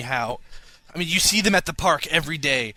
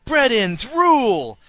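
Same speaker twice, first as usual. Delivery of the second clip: mock triumphant cheer; 7 semitones higher, 5 dB louder, slower than usual. Triumphant listener quote ending.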